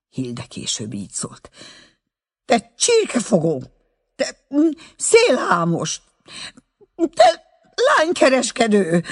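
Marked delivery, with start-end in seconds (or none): 2.4-9.0 much higher, loud mock insults; scolding outburst. A narrator's reading. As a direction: pelt him with names, higher and louder. Girl scolds him after the kiss.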